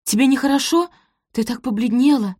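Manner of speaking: high-pitched, anxious concern; alarmed. A narrator's reading. Daughter sees her mother turn pale, alarmed. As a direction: fuss over her with worry. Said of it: "встревожилась Пози".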